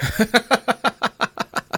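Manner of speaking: high, rhythmic run of laughter; real high-pitched laughter; amused laugh. Real, not acted. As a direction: laugh along at the silly euphemism. Laughing at caller's euphemism.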